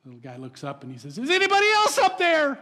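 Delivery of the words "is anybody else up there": high, loud call for someone else; the punchline yell of a scared kid; desperate kid. Retold or imitated. Punchline, kid calls again.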